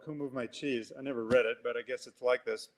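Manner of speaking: ordinary talk, nothing marked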